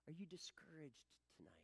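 whispered, gentle question. Real, not acted. Pastor asking the discouraged congregation, whispering. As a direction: lean in and ask softly.

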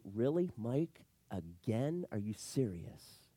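low, slow, understated frustration; quiet exasperation. Acted. Pastor imagining God fed up with him, frustrated. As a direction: drag it out low, fed up but restrained. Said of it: "I'd be frustrated. I would be angry".

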